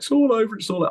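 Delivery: high, wailing mock sob; high, loud mock wailing of a crying boy; crying it's all over. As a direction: wail it like a heartbroken kid. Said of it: "I was crying my eyes out".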